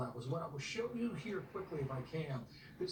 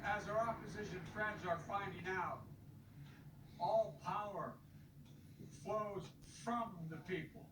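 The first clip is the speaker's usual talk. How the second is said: rally speech; 7 semitones higher than usual. Acted. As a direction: rouse the crowd to go vote. Impassioned rally speech to voters.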